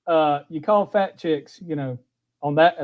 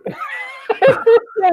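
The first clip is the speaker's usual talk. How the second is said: high shrieking laugh. Real, not acted. Laughs at the dogs.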